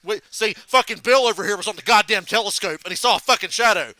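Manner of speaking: loud sarcastic mock tirade; angry Bernie. Imitating Bernie mad at NASA over planet counts, irate sarcasm. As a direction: imitate an irritated, punchy rant. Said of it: "When he gets mad about nasa".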